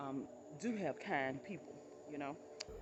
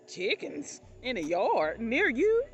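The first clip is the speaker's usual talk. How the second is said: loud, slow, high astonished question; can't believe it, spell it out slowly; incredulous drawn-out question; disbelief; 5 semitones higher, 11 dB louder, slower than usual. Retold or imitated. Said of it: "I was like".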